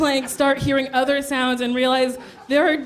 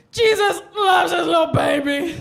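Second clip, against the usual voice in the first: higher, mock-rapturous cry; drunken exclaiming; 4 semitones higher than usual. Drunk traveller finding food open, mock rapturous exclamation.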